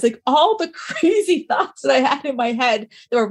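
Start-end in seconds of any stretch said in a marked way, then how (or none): none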